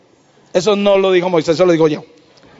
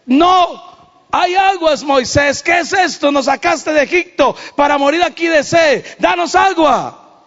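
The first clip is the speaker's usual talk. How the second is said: complaining crowd; 8 semitones higher than usual. Acted. Israelites complain to Moses.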